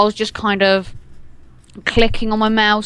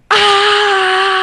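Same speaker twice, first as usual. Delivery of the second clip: high loud mock child yell; imitating a screaming little brother; child's scream imitated; 12 semitones higher, 5 dB louder than usual. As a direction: shriek like a little kid. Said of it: "four-year-old going".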